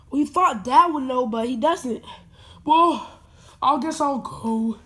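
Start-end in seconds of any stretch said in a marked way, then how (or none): none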